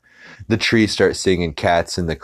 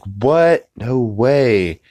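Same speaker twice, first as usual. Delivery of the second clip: mock disbelief; 6 semitones higher, much slower than usual. Everyone reacts to Peter's vision.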